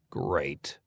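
low, quiet grumbled mutter. A narrator's reading. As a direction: mutter it sourly under your breath. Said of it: "I muttered".

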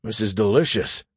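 talking through a mouthful; words round a bite of strawberry; mouth full. A narrator's reading. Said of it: "I spoke with my mouth full".

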